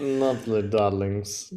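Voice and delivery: slow, lightly voiced fashion-designer drawl; a hint of a grand designer cooing; Edna Mode impression. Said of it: "Edna Mode from The Incredibles".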